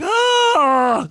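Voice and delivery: loud, high-pitched mock scream; imitated pained cry. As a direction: wail like a player hurt on the field. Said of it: "you were crying like a".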